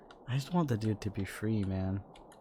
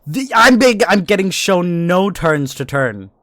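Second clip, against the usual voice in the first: very loud, high, slow put-on voice; hammed-up loud slow goofy voice; mock booming voice; 9 semitones higher, 18 dB louder, slower than usual. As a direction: boom it out like a silly big character. Mocking game voice, loud.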